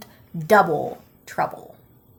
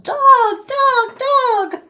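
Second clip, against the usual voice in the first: very high wailing troll cries; troll wails; 14 semitones higher, 4 dB louder, much slower than usual. Acted. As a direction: wail it like trolls losing a dog. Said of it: "cries change to wails of".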